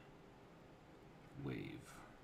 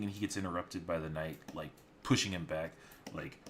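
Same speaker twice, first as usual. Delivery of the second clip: usual speaking voice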